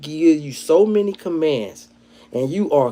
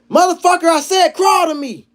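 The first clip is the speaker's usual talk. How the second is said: much higher, louder barking order; cop yelling; 5 dB louder than usual. Retold or imitated. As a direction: bark it like an angry cop. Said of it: "The cop says".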